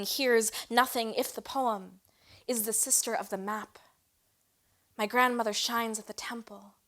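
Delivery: emphatic poetry reading with a hushed ending; spoken-word poem. Acted. Recites poem to its quiet end.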